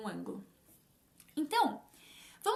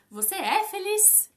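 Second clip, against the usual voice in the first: "Você é feliz?" slow, pitched up as a question; question intonation; 6 semitones higher, 5 dB louder, slower than usual. Shown as a demonstration. Teacher showing learners how a spoken question sounds, questioning intonation. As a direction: lift "Você é feliz?" into a clearly marked question, drawn out. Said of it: "aquela entonação de pergunta".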